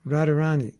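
"Radharani" run together with short vowels; short-syllable mispronunciation. Shown as a demonstration. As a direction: show the careless quick "Radharani". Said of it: "Like some devotees say".

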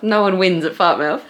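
high, amused and giggly; laughing. Real, not acted. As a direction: chuckle through it. Laughing at game.

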